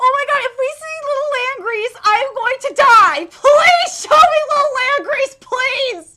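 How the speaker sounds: high, loud desperate pleading; excited begging. Real, not acted. Fan begging to see Little Langreese, excited pleading. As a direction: plead with building, giddy desperation.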